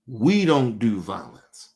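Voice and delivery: "We" hit and held, line slowed; slow, deliberate, weight on "We"; savoring the daughter's words, "We" stressed. Shown as a demonstration. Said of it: "She said we".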